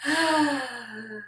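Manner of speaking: slow, voiced exhale; long sigh. Real, not acted. Settling back into meditation.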